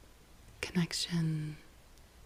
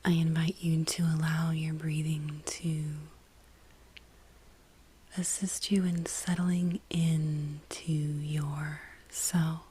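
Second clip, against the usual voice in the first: guided meditation. Real, not acted. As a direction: guide them inward, lingering on each phrase.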